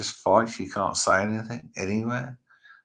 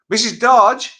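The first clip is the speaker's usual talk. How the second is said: calling out; 12 semitones higher, 12 dB louder than usual. Real, not acted. Calling out to wife.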